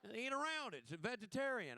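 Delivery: a doubtful caterer squeaks out her answer; mock Southern caterer. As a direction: play the baffled cook, dragging the last words. Mocking Southern caterers' logic.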